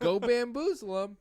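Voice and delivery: high, loud character catchphrase; imitated game quip. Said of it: "so he always says".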